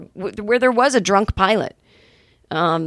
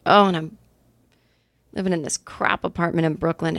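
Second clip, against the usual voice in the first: ordinary talk, nothing marked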